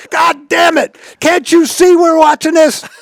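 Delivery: high, loud mock shout of annoyance; angry yell. Ted shouting at Epstein smashing his bat, loud high mock anger. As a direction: bark at the batter for interrupting. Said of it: "Ted's going".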